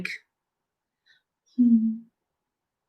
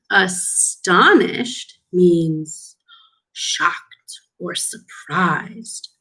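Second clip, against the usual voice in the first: slow; much slower than usual. Shown as a demonstration. Slow vocabulary definition for children.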